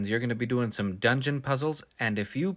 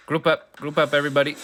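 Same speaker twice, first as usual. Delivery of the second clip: rallying call; 3 semitones higher, 6 dB louder than usual. Real, not acted. Rallying in game.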